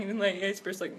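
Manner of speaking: ordinary delivery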